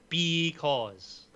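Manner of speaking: dad joke punchline. Acted. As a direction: stretch it to land the pun. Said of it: "That's why the bee crossed the road".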